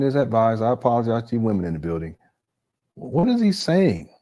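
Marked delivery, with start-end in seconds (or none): 2.9-4.1 high, drawn-out baffled question; baffled disbelief. Real, not acted. Host baffled by what the principal said, incredulous. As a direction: stretch it out high, truly baffled.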